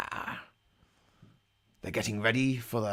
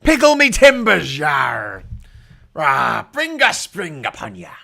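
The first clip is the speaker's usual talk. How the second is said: much higher and louder, a hammed-up pirate shout; pirate accent; 4 semitones higher, 11 dB louder than usual. Commentator playing pirate for his chat, high loud pirate shout. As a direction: roar it out like a pirate on the mast. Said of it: "is the accent hurting your throat yet".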